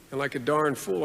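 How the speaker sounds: ordinary delivery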